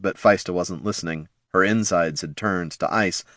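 plain reading voice

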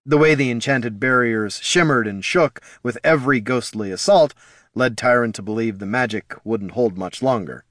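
ordinary delivery

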